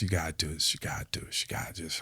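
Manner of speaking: low, barely audible muttering; whispered self-talk. Retold or imitated. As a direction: whisper it to yourself like a private pep talk. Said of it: "I'm telling myself".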